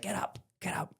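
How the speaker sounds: high, softened urging; urgent pleading. Retold or imitated. Mates to soldier who sat down, urgent pleading. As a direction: urge a mate under your voice, pitched right up. Mates urge man sitting down.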